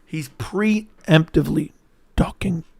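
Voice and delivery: PREEMPTIVELY slowed right down, a break before DUCKING; slow emphatic repeat. Real, not acted. Streamer hammering home his claim about Paddy, slow and deliberate. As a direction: spell it out slowly, word by word.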